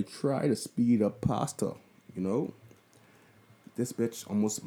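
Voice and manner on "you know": ordinary talk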